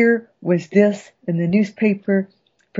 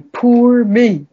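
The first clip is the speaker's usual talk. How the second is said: mock self-pity; 6 semitones higher, 6 dB louder than usual. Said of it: "self-pity".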